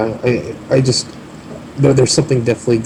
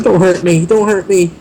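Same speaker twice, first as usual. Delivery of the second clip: high, loud, frightened begging; pleading; 9 semitones higher, 5 dB louder than usual. Acted. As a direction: beg for your life, hands up. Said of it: "holds his hands up and says".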